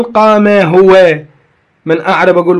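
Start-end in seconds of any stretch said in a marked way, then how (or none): 0.0-1.2 "قام هو" drawn out very slowly and louder; very slow, louder teaching example; slow emphatic example. Shown as a demonstration. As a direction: lay out "قام هو" word by word for the class. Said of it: "صحيح أقول".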